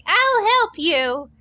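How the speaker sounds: put-on high, slow and earnest like a kid; eager kid voice. Narrator voices the kid agreeing to help Sonic, high and slow. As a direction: offer help wide-eyed and slow.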